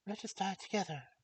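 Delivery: high distressed princess; high anguished princess. Acted. Princess voice in story.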